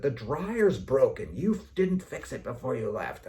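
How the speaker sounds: loud, slow, accusing scold; angry complaint. Retold or imitated. Wife scolding her husband over the dryer, loud and angry. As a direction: blame him for the broken dryer.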